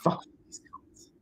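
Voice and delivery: hushed unvoiced whisper; whispered insult. Mocking own countrymen in a whisper. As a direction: breathe it out under your breath. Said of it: "you could just be like".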